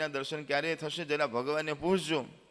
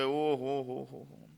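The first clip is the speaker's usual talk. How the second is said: despairing groan; 3 semitones lower, much slower than usual. Acted. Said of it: "એકદમ નિરાશ થઈ ગયા".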